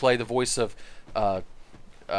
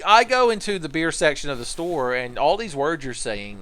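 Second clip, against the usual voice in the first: ordinary talk, nothing marked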